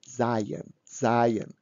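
SEIN said in two syllables, as zai-en; extra e sounded. Shown as a demonstration. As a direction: add a clear e before the final n in sein. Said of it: "we put additional e".

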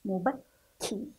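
مبكي slowly, held on the kk; pressed doubled kaf. Shown as a demonstration. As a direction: press on the k of مبكي before releasing ki. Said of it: "نضغط على الحرف قليلًا". (الضغط على الكاف المشددة في مبكي)

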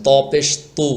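ত and তু heavy, tongue root lifted; thick ত. Shown as a demonstration. Teacher tells learners to thicken ত a little. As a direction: give তু a full, dark emphatic t. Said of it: "একটু মোটা করে বলবেন".

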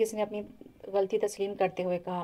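ordinary delivery, nothing marked

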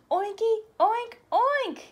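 high, loud gleeful oinks; happy oinking. Acted. Elephant joyfully answering the pig in pig talk. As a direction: oink it like you finally belong. Gerald joins pig greeting.

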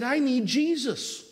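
high, slow, drawn-out mock wail; imitated weeping plea. Weeping lady to the pastor, imitated tearful plea. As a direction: plead like someone breaking down. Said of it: "just break down weeping".

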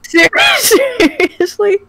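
high, slow, laughing Seriously?; laughing disbelief. Real, not acted. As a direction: drag it out through laughter.

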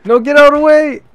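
loud, high-pitched yell; exasperated shout. Real, not acted. Gamer yelling get out of the way, exasperated shout. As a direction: yell at whatever is blocking you. Calling out at obstacle in game.

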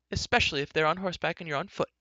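put-on high, quick girlish chatter; princess. Princess chiding the youth on foot, quick and high.

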